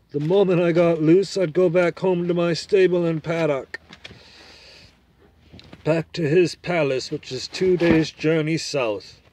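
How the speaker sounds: put-on a confident horse making its case to the boy; talking horse. Horse explains plan.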